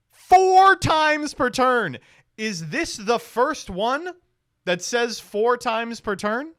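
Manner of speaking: high, drawn-out incredulous cry; loud disbelief. Real, not acted. Player stunned by a card's effect limit, loudly incredulous. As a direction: stretch it out in high-pitched astonishment.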